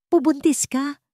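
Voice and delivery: frightened, high trembling question; stunned fearful half-whisper. A narrator's reading. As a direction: ask it in shock, voice shaking. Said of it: "Pabulong na tanong ni Estrella. Ang boses ay nanginginig".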